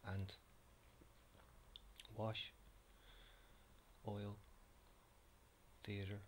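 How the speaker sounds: bland East Coast Irish accent, words read evenly; own generic Irish accent. Shown as a demonstration. Whisperer reading the accent tag list to viewers, own generic Irish accent. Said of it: "in my own bland, generic Irish accent".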